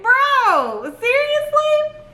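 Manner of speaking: high-pitched, drawn-out disbelief; incredulous. Retold or imitated. Frog-scared driver to unhelpful neighbor, incredulous. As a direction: stretch it way up high in mock disbelief.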